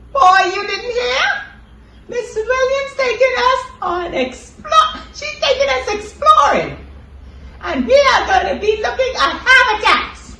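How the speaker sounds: very high, loud excited puppet; squeaky puppet voice. Acted. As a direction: squeal it like an excited cartoon kid. Puppet announces the trip.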